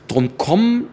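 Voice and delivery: KOMM lifted high, rising; stress on KOMM. Retold or imitated. Actor recalls his Faust audition line, stressing KOMM. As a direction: lean on "komm" with a rising tune. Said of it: "betone und nicht so und so".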